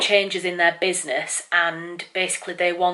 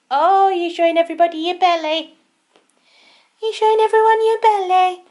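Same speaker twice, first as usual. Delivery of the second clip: silly cat voice; 12 semitones higher, 4 dB louder than usual. Real, not acted. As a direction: coo at the cat in a sing-song voice. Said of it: "I'm gonna stop talking in silly cat voice now".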